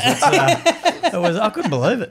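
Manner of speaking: ordinary talk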